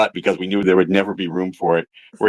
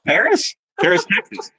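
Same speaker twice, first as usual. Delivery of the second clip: high-pitched mock disbelief; incredulous question; 11 semitones higher than usual. Questioner baffled by the baker's hometown, acted incredulous. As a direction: repeat it back much higher, puzzled.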